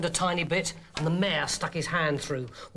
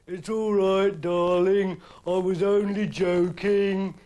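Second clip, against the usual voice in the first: louder, slow, soothing strongman; calm her, unbothered; a touch of smug comfort; reassuring; 5 dB louder, slower than usual. Acted. Samson reassuring.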